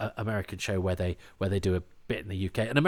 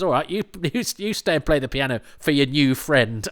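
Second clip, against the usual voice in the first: high, louder peeved retort; mock annoyed; 5 semitones higher, 7 dB louder than usual. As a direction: snap at him like a miffed companion.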